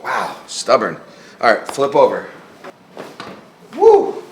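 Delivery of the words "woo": excited whoop. Real, not acted. Exclaiming during spinal adjustment.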